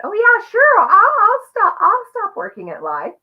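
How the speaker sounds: high, loud, eager agreeing voice; gushing innocent yes; naive innocent self. Retold or imitated. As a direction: play your naive younger self saying yes brightly. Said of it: "always innocent".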